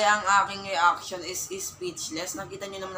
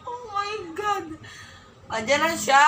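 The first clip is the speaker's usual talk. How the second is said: thrilled; 9 semitones higher, 6 dB louder than usual. Real, not acted. Reacting to character arriving.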